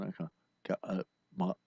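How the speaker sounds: low, quiet throaty Australian accent; Australian from the back of the throat, lips barely moving. Shown as a demonstration. Honorary Australian showing off the accent, throaty and tight-lipped. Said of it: "my voice in the back of my throat".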